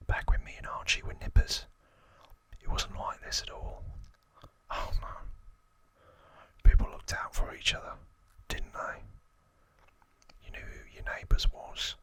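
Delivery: lightly acted Cockney gangster, whispering; Cockney accent. Gangster reminiscing about childhood with Archie, whispered Cockney accent. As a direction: whisper a put-on Cockney grumble. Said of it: "That's my best Cockney accent".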